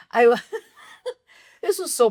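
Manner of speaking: laughing through the words. Real, not acted. Fan laughing about how painful the film was. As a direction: laugh it out in disbelief.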